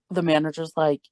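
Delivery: ordinary talk, nothing marked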